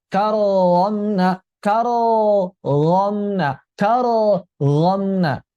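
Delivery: 'Karramna' slow, split as karo-romna; bouncing ro. Shown as a demonstration. Teacher shows the mistake, bouncing ro.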